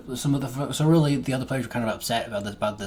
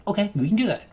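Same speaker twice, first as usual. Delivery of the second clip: pitched way up and louder; high mock-friendly okay; 11 semitones higher, 5 dB louder, faster than usual. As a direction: chirp it high and bright to win the player over. DM quoting his reply to player.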